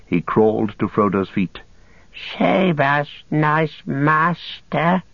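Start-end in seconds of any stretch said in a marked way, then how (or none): 2.4-5.0 high, very slow whining creature plea; Gollum whining. Acted. Captive crawling at his master's feet, high whining plea. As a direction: crawl and beg. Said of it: "he whined".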